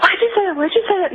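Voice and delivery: high-pitched imitation of a frightened child; retold terror. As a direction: mimic a panicked daughter, pitch jumped way up. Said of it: "she was just terrified".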